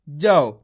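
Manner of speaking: जाओ said ja-au; pron demo. Shown as a demonstration. Teacher repeats जाओ in the drill. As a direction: model जाओ clearly. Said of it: "तो जा को कैसे पढ़ रहे हैं?".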